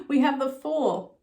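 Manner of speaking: usual speaking voice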